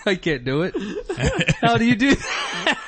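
normal talking voice

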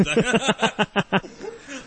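giggle, trying to hold it in; stifled giggles. Real, not acted. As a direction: burst of giggling that breaks through. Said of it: "I can't even keep a straight face".

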